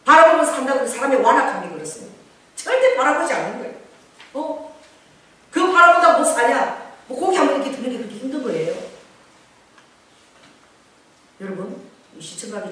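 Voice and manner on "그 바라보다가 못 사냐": rebuking cry. Acted. Preacher scolding stubborn people.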